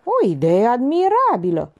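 grand approval; decreeing. A narrator's reading. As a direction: declare it like a verdict. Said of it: "decretă Jack Moraru".

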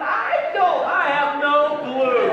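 high, loud and drawn out, at a loss; bewildered outburst. Retold or imitated. Dad answering his son about the swallowed ring, bewildered and loud. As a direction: throw up your hands, no idea at all. Son asks if it will hurt.

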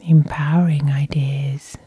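ordinary talk, nothing marked

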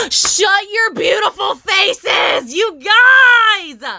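real ecstatic scream, drawn out; excited yelling. Real, not acted. Vlogger thrilled at what she unboxed, excited yelling. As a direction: stretch it out, ending in a loud high scream. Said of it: "Sorry, I yelled".

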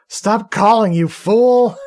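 high, loud mock scream; retold scream. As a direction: scream it at the caller in mock outrage. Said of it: "she screams at him".

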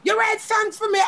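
high, thrilled "what?"; excited disbelief. Retold or imitated. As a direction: squeal it out in happy disbelief. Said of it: "And I got so excited".

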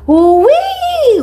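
high wailing siren wee; high siren call. A narrator's reading. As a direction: cry out like a police siren. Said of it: "Went a siren".

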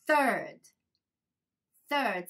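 "third" with th made by tongue between the teeth. Shown as a demonstration. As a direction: model "third" carefully, biting the tongue for th. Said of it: "ベロ、舌を噛んでください". (舌を噛んで「third」を丁寧に発音)

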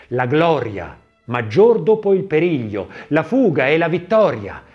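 usual speaking voice

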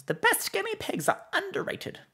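very high-pitched character voice; Mister Whiskers. Acted. Streamer reading out a chat request, in Mister Whiskers voice. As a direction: leap far up into a character voice. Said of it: "In Mister Whisker's voice".

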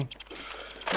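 hushed whispered curse; whispering while hiding. Real, not acted. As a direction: curse under your breath.

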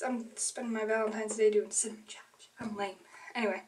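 soft unvoiced whisper aside. Real, not acted. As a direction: whisper it like a confession. Self-deprecating aside to camera.

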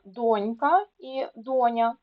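ДОнька і ДОня, said slowly; донька і доня with clear initial stress; stress on first syllable. Shown as a demonstration. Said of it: "наголос на перший склад".

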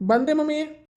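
loud, high call to mom in another room. Acted. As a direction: shout back that you're coming. Calling back to mother. (ದೂರದ ಅಮ್ಮನಿಗೆ ಜೋರಾಗಿ ಕೂಗಿ ಉತ್ತರ)

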